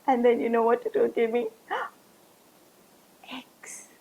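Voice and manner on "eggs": whispered gasp of wonder; breathless whisper. Acted. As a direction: breathe the word like a secret treasure. Gasped reveal of eggs.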